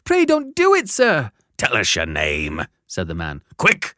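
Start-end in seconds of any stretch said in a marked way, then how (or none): 0.1-1.3 put-on high, loud terrified child; pleading in terror. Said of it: "I pleaded in terror". 1.6-2.7 gruff convict. Acted. Convict interrogates the boy. 3.6-3.9 barked order. Acted. Convict demands.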